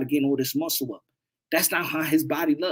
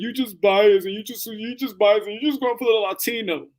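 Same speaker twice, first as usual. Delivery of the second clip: mock critic; 5 semitones higher than usual. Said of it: "Or they're gonna be like".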